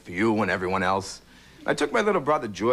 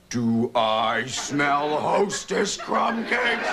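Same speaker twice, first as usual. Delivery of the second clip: very slow, dreamy sniffing voice; enraptured husband; 2 semitones higher, much slower than usual. Retold or imitated. Husband in the ad catching the scent of cake, slow and dreamy. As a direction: savour every word like a tempted man.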